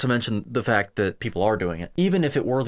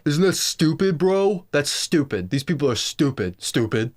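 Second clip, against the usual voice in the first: mock dumb bro; 3 semitones higher than usual. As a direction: sound like a dumb bro sneering at everything. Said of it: "just saying things like".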